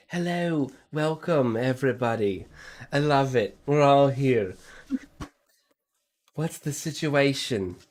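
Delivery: a showy friar welcoming guests; flamboyant friar. Acted. Friar welcomes the party at the docks, gushing and showy.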